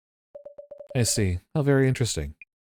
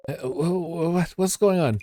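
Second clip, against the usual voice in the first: much higher, tripping over words; flustered and lost; dazed victim coming to in alarm; stammering confusion; 9 semitones higher, slower than usual. Acted.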